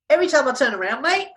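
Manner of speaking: put-on Australian accent. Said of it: "in an Australian accent".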